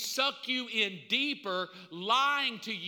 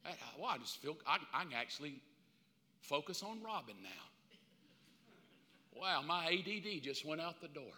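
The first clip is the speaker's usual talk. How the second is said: lower, much softer dazed voice; stoned self; 5 semitones lower, 11 dB quieter than usual. Retold or imitated. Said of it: "My first day on marijuana, I was like".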